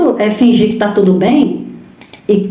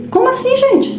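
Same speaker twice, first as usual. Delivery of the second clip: much higher, exasperated "como assim"; indignant disbelief; 13 semitones higher than usual. Real, not acted. Preacher to women in audience, indignant disbelief. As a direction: throw up your hands at the absurdity.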